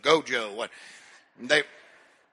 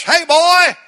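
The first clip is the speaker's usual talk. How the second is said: high, loud call across the room; 11 semitones higher, 8 dB louder than usual. Retold or imitated. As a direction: call out to the boy, urgent. Voice calling him to church.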